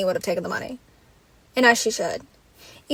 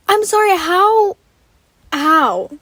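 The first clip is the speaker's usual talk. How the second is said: high, slow, drawn-out disbelief; can't believe what she's seeing; incredulous; 6 semitones higher, 4 dB louder, slower than usual. Real, not acted. Vlogger reacting to the finale result, incredulous.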